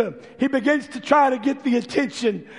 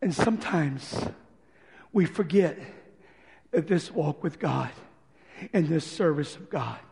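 softer, lower sincere preaching; soft earnest appeal; 4 semitones lower, 4 dB quieter than usual. Acted. Preacher quietly urging the church, soft sincere appeal. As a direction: bring it down and speak from the heart.

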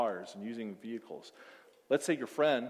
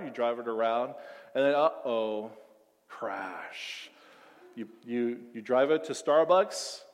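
ordinary talk

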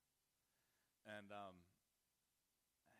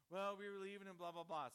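mock excuses; 8 semitones higher, 8 dB louder than usual. Daughter's excuses mimicked.

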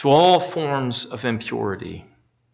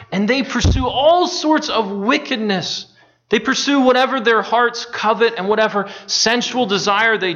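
higher, louder preaching at full force; impassioned preaching; 7 semitones higher, 3 dB louder than usual. Acted. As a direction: preach it out, rising with conviction.

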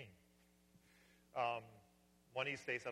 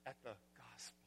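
hushed whisper; 10 dB quieter than usual. Real, not acted. Hushed end of exhortation.

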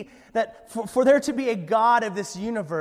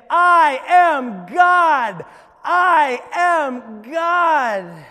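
very loud, slow, high declaration; yelling; 8 semitones higher, 12 dB louder, much slower than usual. Acted. Shirley at the ocean's edge with arms stretched, yelling boldly. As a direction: yell it to the heavens, stretched out. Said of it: "she yelled over and over again".

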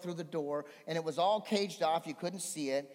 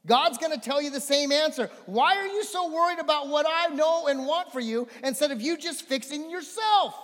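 loud, high preacher's voice driving the scripture home; full-force preaching; 10 semitones higher, 8 dB louder than usual. Acted. Pastor booming Job's words to the church, full-force preaching. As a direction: preach it out big to the congregation.

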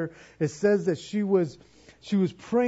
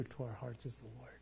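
soft whisper; 5 semitones lower, 17 dB quieter, faster than usual. Real, not acted. Pastor closing a point softly.

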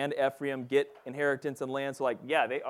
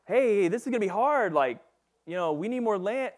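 mock whining; 8 semitones higher, 5 dB louder than usual. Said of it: "they're turning back and saying".

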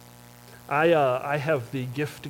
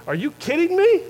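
much higher, exasperated disbelief; incredulous disciples; 7 semitones higher than usual. Pretend. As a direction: act out the disciples' baffled protest at Jesus. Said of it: "this is probably what I would say".

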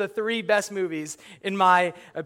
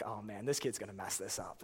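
much softer, low muttered dread; 10 semitones lower, 15 dB quieter than usual. Retold or imitated. Viewer muttering at the TV about the doomed kid. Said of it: "and I'm like".

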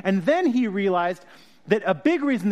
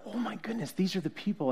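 hushed awe; 10 dB quieter than usual. Retold or imitated. Quiet awed realization retold.

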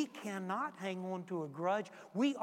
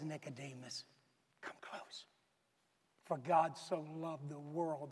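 tender Jesus; 4 semitones lower, 5 dB quieter than usual. Acted. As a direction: draw him in gently, lower. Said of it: "he smiles and says".

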